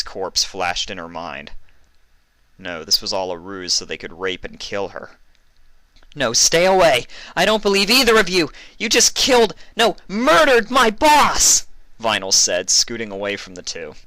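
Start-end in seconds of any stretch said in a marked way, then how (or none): 6.1-11.7 very high, loud panicked yelling; terrified shouting. Acted. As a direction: scream at them to stay away.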